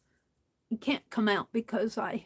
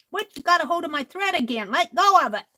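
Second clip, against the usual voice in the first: higher, louder, fast scolding; a crafter scolding her pet mid-stitch; mock scolding; 6 semitones higher, 7 dB louder, faster than usual. Real, not acted. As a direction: shoo the cat off the thread. Scolding the cat at thread.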